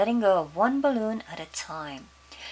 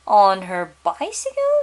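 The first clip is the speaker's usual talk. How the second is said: shocked; 10 dB louder than usual. Acted. Father shocked at bicycle news.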